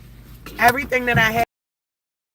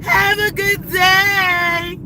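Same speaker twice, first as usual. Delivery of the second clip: put-on high, loud, slow child voice calling out; imitating kids; 10 semitones higher, 5 dB louder, much slower than usual. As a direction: call out like a sweet little kid. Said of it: "the little kids was outside over there like".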